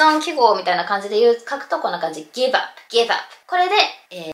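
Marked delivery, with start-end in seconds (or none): 2.3-3.1 "give up" as one word, "gi-vap"; linked pronunciation. Shown as a demonstration. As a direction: repeat "give up" with v joined to up, p unreleased. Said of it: "正しい発音っていうこと". (「give up」をギバッと一語のように発音)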